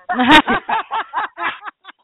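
big burst of high laughter; a real high laugh; loud laugh. Real, not acted. Laughing at own story.

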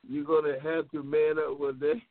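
usual speaking voice

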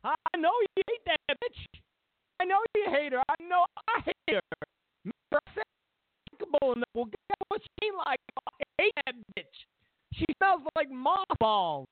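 high mocking confession; mock Obama rant. Mocking Obama admitting he hates Hillary, high. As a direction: ham up a candid rant. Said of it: "he was doing everything but saying".

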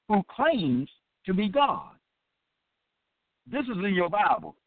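ordinary talk

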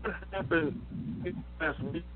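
fading out over a phone call; radio breaking up. Real, not acted. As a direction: voice cutting out on a bad line. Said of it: "you fading away".

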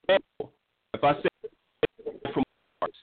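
choppy cutting-out phone line; words dropping out over a bad line; phone breaking up. Real, not acted. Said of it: "You're breaking up, brother".

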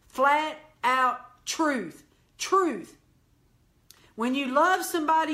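hard-hitting pulpit admonition; emphatic preaching. Real, not acted. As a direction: drive the truth home in short, punched phrases.

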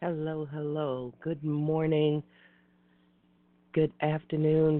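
ordinary talk

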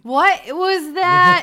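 high, loud and slow; mock surprise. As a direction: gasp out a hammed-up what was that. Mock reaction to tiny sneeze.

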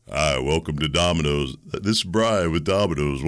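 Domino's phone greeter. Retold or imitated. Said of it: "I could do this pretty accurately".

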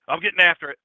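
loud barked "Get after it"; Dennis's shout. Retold or imitated. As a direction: shout it like a pumped-up coworker. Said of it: "He'd say".

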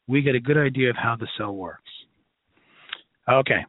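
normal talking voice

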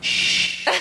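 long hushing hiss; playful shush. Retold or imitated. Runner silencing friends about hating running, playful shush. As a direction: shush them to keep it secret.

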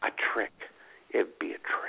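whispered, breathy and secretive; mock whisper. Prank caller whispering the trick at the door. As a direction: hush it like a kid plotting a prank.

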